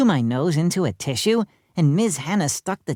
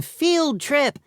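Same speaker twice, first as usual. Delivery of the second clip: excited yell; 11 semitones higher than usual. A narrator's reading. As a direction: cheer like a thrilled pupil. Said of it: "Everybody started yelling".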